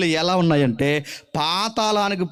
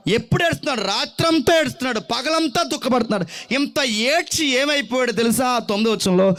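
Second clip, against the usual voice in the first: higher, emphatic pulpit voice; fiery preaching; 8 semitones higher than usual. Acted. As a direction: drive it home to the congregation. Preaching at full force. (ఉద్రేకంతో ప్రసంగం)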